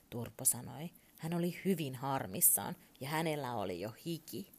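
usual speaking voice

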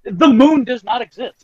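mock frantic shout. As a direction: shout it like a wild conspiracy nut. Joining the rant.

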